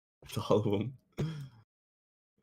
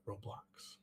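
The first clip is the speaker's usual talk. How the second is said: whispering; 14 dB quieter than usual. Acted. Hushed mock commentary.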